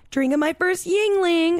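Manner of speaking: high, drawn-out excited mock text; excited announcement. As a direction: gush it like big news to friends. Retells excited text to friends.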